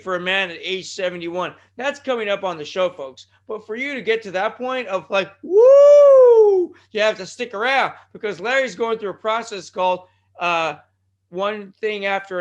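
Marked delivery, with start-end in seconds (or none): 5.4-6.7 high, long, loud "woo" whoop; sustained piercing wrestler's whoop; Ric Flair. Retold or imitated. Host hyping listeners for the segment, long whooping woo. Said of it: "That's my Ric Flair shimmy".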